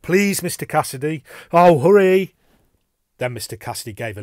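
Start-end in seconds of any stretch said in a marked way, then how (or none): 0.0-2.3 high, loud, pleading warning; raised voice urging him to hurry; urgent calling out. A narrator's reading. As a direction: call out anxiously, building to a shout. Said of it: "I called out".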